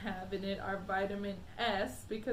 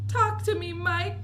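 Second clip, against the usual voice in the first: high, loud desperate plea; crying out; 9 semitones higher, 9 dB louder than usual. A narrator's reading. Mother begging her son to speak, frantic. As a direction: beg him to answer. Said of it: "cried Missus T V".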